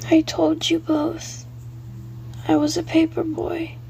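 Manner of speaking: lightly voiced dead paper boy, insistent; ghost boy. Billy's ghost voice.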